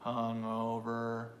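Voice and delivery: hungover drawn out slowly; slow demonstration. Shown as a demonstration. Teacher asks where the stress is, slowly drawn out. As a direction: stretch hungover so the stress can be found. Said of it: "Where is the stress".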